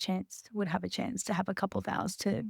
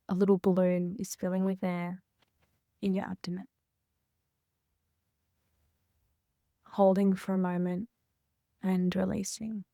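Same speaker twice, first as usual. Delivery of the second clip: calm breathing guidance, pausing between phrases; guided meditation. Real, not acted. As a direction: guide each breath gently, leaving room.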